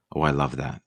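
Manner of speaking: ordinary delivery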